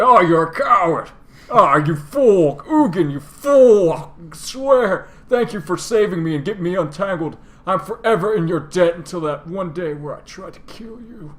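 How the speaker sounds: high, loud, hammed-up scorn; theatrical dragon outrage. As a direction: cry out grandly at a cowardly brother. Voices dragon scorning his brother.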